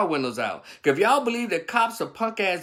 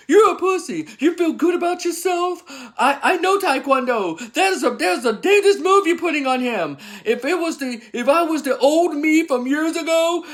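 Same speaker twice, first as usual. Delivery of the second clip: high, loud mock ranting at a cop; hammed-up bystander tirade, much higher and louder; imitating bystander yelling; 8 semitones higher, 5 dB louder than usual. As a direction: rant at the officer like an angry onlooker, pitched up. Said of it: "yelled at that punk-ass cop".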